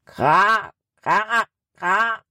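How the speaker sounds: faraway crow caw with a rising end; distant crow. A narrator's reading. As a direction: crow cawing from far off in the forest. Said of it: "antwortete eine Krähe im Wald".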